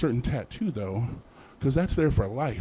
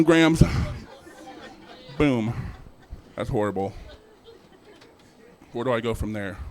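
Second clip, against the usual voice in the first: ordinary talk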